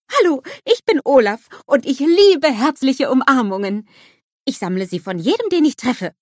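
lightly voiced proud little snowman; Olaf, proud. A narrator's reading. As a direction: introduce yourself with beaming pride. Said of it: "erzählt er stolz".

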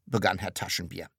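ordinary delivery

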